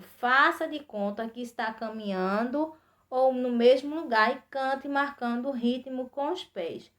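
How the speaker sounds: normal talking voice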